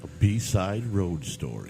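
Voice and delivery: a mock segment title; mock announcer. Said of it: "another road story from B-Side".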